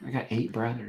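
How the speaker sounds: lightly imitated Southern mom; soft, low hint of a Southern accent. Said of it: "my mom has the Southern accent".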